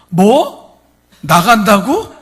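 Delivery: loud, high threats; furious. Retold or imitated. 나가려는 남편에게 분노해 협박하는 아내. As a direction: threaten the husband with everything. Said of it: "막 욕을 하는".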